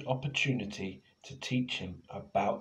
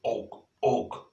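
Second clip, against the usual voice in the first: grunted og og; guttural caveman grunts. Acted. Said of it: "It sounded like".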